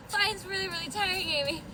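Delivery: soft, winded panting speech; out of breath. Pretend. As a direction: puff it out, worn out from flapping. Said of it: "Are you out of breath?".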